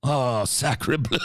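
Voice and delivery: put-on slow French accent, lightly done; drawn-out mock French exclamation; french chef. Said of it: "it went".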